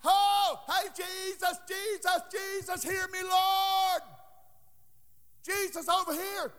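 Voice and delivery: high, drawn-out calling; acted hollering. Preacher acts blind man begging Jesus to hear, acted hollering. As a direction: cry out high to be noticed over a crowd. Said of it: "He started hollering".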